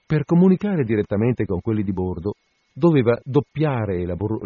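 usual speaking voice

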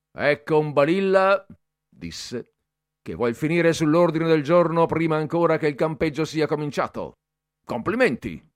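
mock him in front of everyone; ironic. A narrator's reading. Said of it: "Da ironico". (Bertoli deride il ragazzo davanti a tutti, ironico e forte)

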